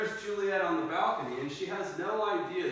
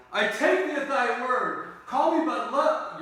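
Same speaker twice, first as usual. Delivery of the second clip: bold Romeo; 7 semitones higher, 6 dB louder than usual. Acted. Romeo boldly reveals himself to Juliet, loud bold declaration. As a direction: call it out bravely from the dark. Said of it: "He finally gets brave and he steps out".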